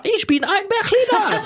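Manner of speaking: mock German accent, pitched high. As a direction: proclaim it in a hammed-up German accent. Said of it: "j'étais là". (accent allemand exagéré, voix haute et solennelle)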